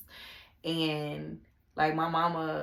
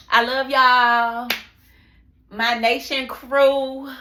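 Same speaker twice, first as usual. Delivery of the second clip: much louder and higher, slow and drawn out; loud happy sign-off; 7 semitones higher, 12 dB louder, slower than usual. Real, not acted. Signing off to her nation crew, loud and slow. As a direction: call it out big to the fans. Excited outro to viewers.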